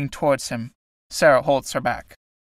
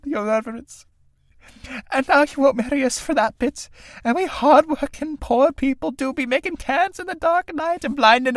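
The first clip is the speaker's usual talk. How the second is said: sobbing; 10 semitones higher than usual. A narrator's reading. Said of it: "Sarah, sobbing".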